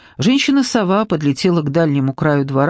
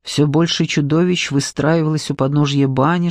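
plain reading voice

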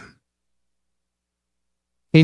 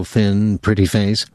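usual speaking voice

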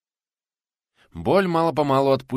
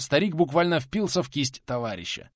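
ordinary delivery

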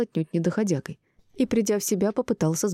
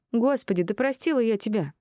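higher-pitched exasperation; fed-up, irritated retort; 6 semitones higher than usual. A narrator's reading. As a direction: toss it off in annoyance. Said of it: "раздражённо бросила она".

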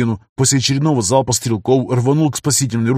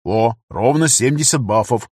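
ordinary delivery, nothing marked

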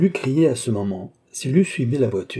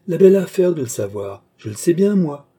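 higher and louder, brushing it aside; emphatic retort; 7 semitones higher, 6 dB louder than usual. A narrator's reading. Cagliostro dismisses Oliva's fear, louder and higher. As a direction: scoff at her worry, raising the voice.

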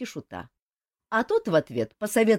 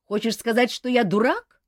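louder, heated challenge rising on я; flaring up; 6 semitones higher, 6 dB louder than usual. A narrator's reading. Bastard prince to the king, flaring up. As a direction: bristle and demand an answer. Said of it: "закипятился принц-бастард".

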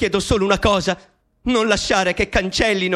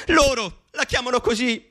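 normal talking voice

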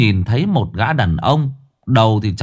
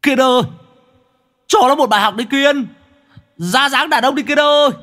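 urging shout, pitched very high; strained high cheer from the sidelines; high-pitched yelling. Acted. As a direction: yell encouragement to a fighter. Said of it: "hét lên".

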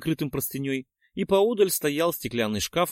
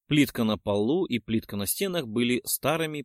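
ordinary delivery

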